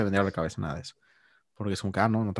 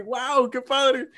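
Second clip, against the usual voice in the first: much higher, very slow drawn-out wow; amazed; 14 semitones higher, much slower than usual. Retold or imitated. Remembering his delight at the date paying, slow and amazed. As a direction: savor the amazement.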